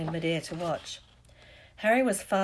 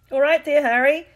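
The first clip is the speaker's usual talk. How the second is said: high, very loud shout; hearty, full-voiced holler; yell; 9 semitones higher, 13 dB louder than usual. A narrator's reading. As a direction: yell it up to a friend in mid-air. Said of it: "he had time to yell".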